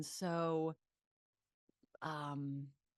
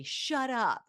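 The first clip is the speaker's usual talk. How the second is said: retold shut up; 7 semitones higher, 8 dB louder than usual. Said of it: "I just say".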